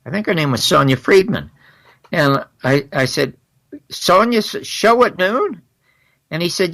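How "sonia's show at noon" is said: high, slow questioning echo; incredulous question. Retold or imitated. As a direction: repeat it back high-pitched, in disbelief. Retold incredulous question to caller.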